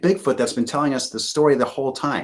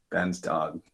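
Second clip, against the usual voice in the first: ordinary delivery, nothing marked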